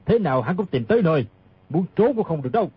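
low, rapid retort; arguing back. Acted. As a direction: argue fast in a low, scornful voice. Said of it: "Bao Bất Đồng cãi lại". (giọng trầm, cãi lại dồn dập)

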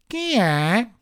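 high small voice from inside; little voice. Acted. Voice inside the hut asking who knocks, small and high. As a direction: answer like something tiny behind the door. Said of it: "disse una vocina di dentro".

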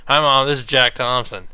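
loud mock caller. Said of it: "He goes".

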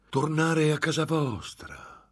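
ripetuto lento e beffardo, molto più alto; crudele ironia. A narrator's reading. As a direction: schernisce la sua richiesta. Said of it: "ripeté il monaco con crudele ironia". (il monaco ripete con sarcasmo crudele)

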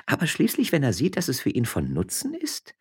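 high, sly insinuating woman; sly high wife. A narrator's reading. Josefa hinting slyly to her husband, high and knowing. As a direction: pitch up and insinuate. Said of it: "rümpfte die Nase dabei".

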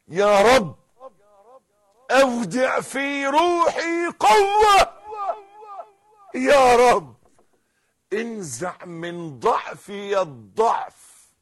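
loud, drawn-out plea to God; high, slow, impassioned supplication; fervent shouted prayer. Acted. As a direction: call out to God at full force. Models a fervent prayer aloud.

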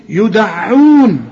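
slow يدعون, the ع clearly pressed; تحقيق العين المشددة في يدعون; doubled ain realised. Shown as a demonstration. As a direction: stress the doubled ع in يدعون without nasalising. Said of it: "العين أي نعم هي مشددة لكن بحققها".

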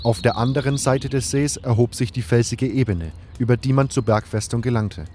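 normal talking voice

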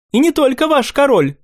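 put-on high light young nobleman; young prince voice.

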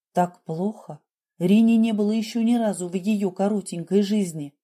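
usual speaking voice

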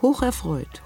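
ordinary talk, nothing marked

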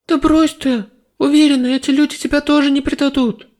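high, loud insisting; insistent girl. A narrator's reading. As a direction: press him hard. Goddess argues with hero.

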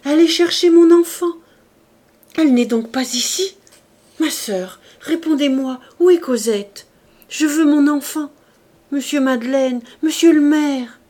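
loud, high, desperate pleading; frantic crying out. A narrator's reading. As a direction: beg for your child in panic. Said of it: "s'écria-t-elle".

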